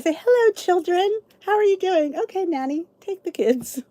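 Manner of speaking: lightly voiced rich mother greeting kids; a hint of a grand, airy parent; breezy rich parent. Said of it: "But you just say".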